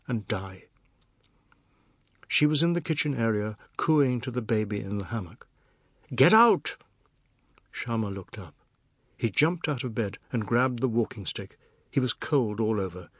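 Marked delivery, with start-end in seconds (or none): 6.1-6.8 loud high yell; shouted. A narrator's reading. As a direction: scream her away in terror. He screams at wife.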